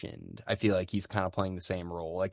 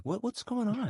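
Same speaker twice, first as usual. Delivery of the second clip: high confused voice. Retold or imitated. Family voiced asking guard.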